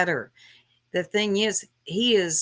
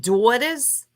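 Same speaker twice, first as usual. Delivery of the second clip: mocking, questioning "Daughters?" in a New York accent, higher and rising; mock East Coast gangster; 4 semitones higher than usual. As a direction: throw "Daughters?" back in a fake Boston accent. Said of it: "when you said it like that".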